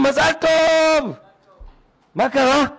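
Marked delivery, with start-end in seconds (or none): 0.0-1.1 high, slow cry of מזל טוב; drawn-out celebratory shout; joyful shout. Retold or imitated. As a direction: everyone cheering at once. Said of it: "כולם צועקים".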